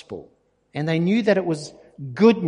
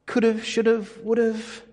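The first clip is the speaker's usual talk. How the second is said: higher, sighing regret over missed chances; mock regret; 6 semitones higher than usual. Preacher mimics believers' regret, mock wistful. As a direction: play the wistful what-if, pitched up. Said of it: "Most times we say".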